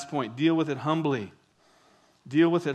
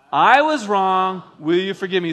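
leading group recitation; 8 dB louder than usual. Shown as a demonstration. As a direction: lead the congregation word by word, firmly. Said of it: "Let's say those words together".

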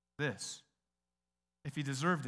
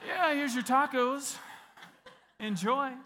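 cheery; 9 semitones higher, 5 dB louder, slower than usual. Retold or imitated. Cashier hands over tacos gleefully.

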